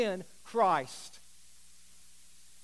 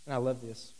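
ordinary delivery

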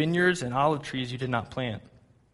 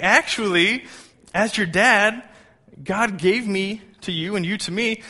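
higher-pitched explaining to a little one; dad to child; 6 semitones higher, 5 dB louder, slower than usual. Retold or imitated. Dad telling his young son he is his teacher, high and eager.

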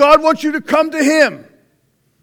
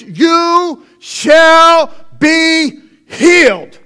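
slow, drawn-out shout, higher and louder; proclaiming shout; 4 semitones higher, 5 dB louder, much slower than usual. Acted. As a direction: cry it out like a prophet. Preacher proclaims the healing.

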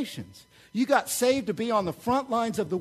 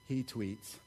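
ordinary talk

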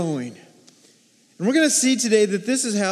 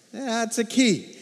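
ordinary talk

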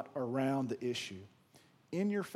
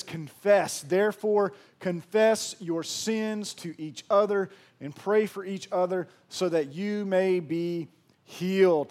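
forceful scripture reading; 2 semitones higher, 6 dB louder, slower than usual. Acted.

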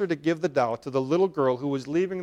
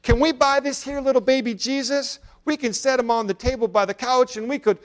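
put-on high, louder child's voice, eager and hopeful; eager little girl; 9 semitones higher, 5 dB louder than usual. A narrator's reading. Little girl asks her mother to buy the baby Jesus, high eager child voice. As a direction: beg like a small child who wants the doll. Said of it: "called to her".

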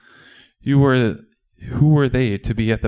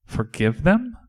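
higher, rising, disbelieving question; incredulous, sharply rising question; incredulous echo; 6 semitones higher, slower than usual. Acted. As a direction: echo it back in disbelief, pitch climbing. Disciple echoes Jesus in disbelief.

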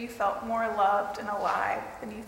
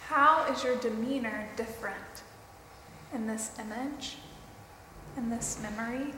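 guided meditation; 3 dB quieter than usual. Acted.

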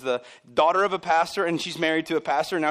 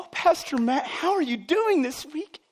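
high, slow sing-song greeting; gushing churchgoer; 11 semitones higher, slower than usual. Acted. Churchgoer asking the pastor about his week, gushing. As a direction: overdo the sweet concern for the pastor.

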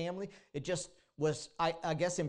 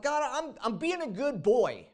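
mock self-righteous boast; 6 semitones higher, 4 dB louder than usual. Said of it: "He's like".

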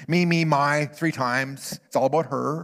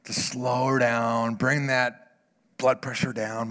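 normal talking voice

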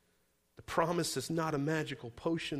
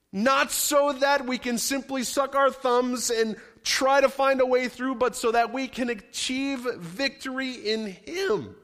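fast, loud, rising pulpit preaching; full-force preaching; 7 semitones higher, 6 dB louder than usual. Acted. Pastor preaching to his church, full force and loud. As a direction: drive the point home to the congregation.

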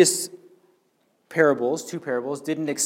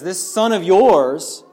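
slow, disdainful "This son of yours", pitched higher; contemptuous; 5 semitones higher, 6 dB louder, slower than usual. Acted. Older brother disowning his sibling to his father, with scorn. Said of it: "not even being able to call him his brother".

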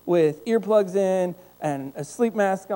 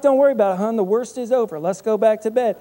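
louder, higher, breezy fake confidence with a laugh; cheery lie; 5 semitones higher, 6 dB louder than usual. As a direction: sell the lie brightly. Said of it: "I'm like".

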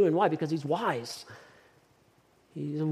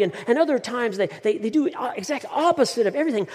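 earnest pleading psychologist; 6 semitones higher than usual. Acted. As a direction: plead urgently, stumbling over the words. Psychologist pleads his second wish.

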